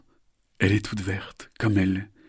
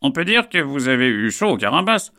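high, loud bird of prey; proud raptor speaking; stern falcon voice; 7 semitones higher, 7 dB louder than usual. Acted. Falcon Alain arrives.

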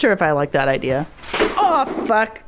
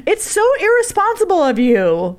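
mock scolding; 11 semitones higher than usual. Mock scolding friend over water.

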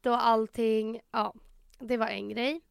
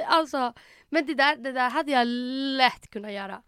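ordinary talk

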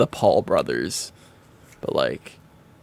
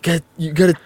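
mock motivational speaker; 6 semitones higher, 6 dB louder than usual. Hyping up a hesitant cohost.

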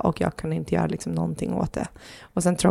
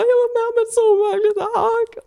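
very high, louder, tearful wail; breaking down; 4 dB louder than usual. Retold or imitated. Telling her partner at home what happened, tearful and high. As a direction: let it burst out as the tears come. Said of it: "det bara brister och jag blir så ledsen".

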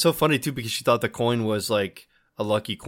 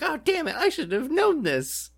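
frustrated waitress. Retold or imitated. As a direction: squeal it like she just missed the answer. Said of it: "She's like".

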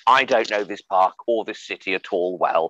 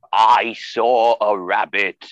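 very slow, drawn-out, louder; improvised excuse; 5 dB louder, much slower than usual. Retold or imitated. Improvising an excuse to a policeman, slow and deliberate. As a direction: stretch out each word of a feeble excuse. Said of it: "I improvise a lot for a living. I said".

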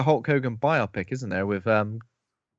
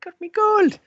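very high, louder squeaky leprechaun; 4 dB louder than usual. Retold or imitated. Mimicking the film's leprechaun, very high squeaky voice. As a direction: squeal it like a greedy little leprechaun.

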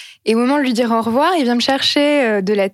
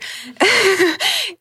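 laugh; 11 semitones higher than usual. Real, not acted. Laughing about the teacher's remark.

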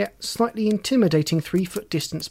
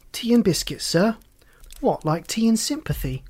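put-on higher-pitched young assistant; puzzled junior officer; 5 semitones higher than usual. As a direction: query the boss in a higher voice. Junior Evans questions the inspector.

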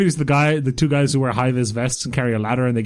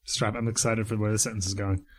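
quiet teasing; 5 semitones lower, 10 dB quieter than usual. Real, not acted.

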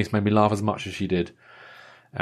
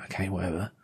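quiet, bored inner thought; unimpressed aside; 4 semitones lower, 12 dB quieter, faster than usual. Retold or imitated. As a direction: humour them, not impressed. Inner thought while humoring.

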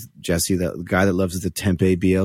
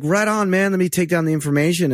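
breezy fake enthusiasm; 9 semitones higher than usual. Brushing off a fan, playing along.

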